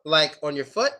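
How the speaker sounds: ordinary delivery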